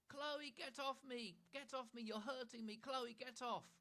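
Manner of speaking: high, loud, slow fake cries for help; fake shouting. Annabel pretending Chloe hurts her, high fake shouting. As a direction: wail it like a lying little sister.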